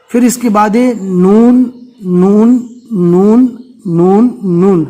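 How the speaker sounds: slow, emphatic نون with a held nasal; clear noon. Shown as a demonstration. Teacher drilling pupils on the letter, نون slow and held. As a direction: draw out نون for the class. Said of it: "اس کو سمجھنا بہت ضروری ہے".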